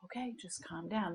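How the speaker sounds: soft, patronising calm-down line; mock calm-down. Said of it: "you're down here and you're like".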